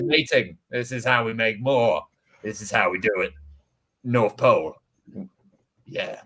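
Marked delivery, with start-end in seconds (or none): none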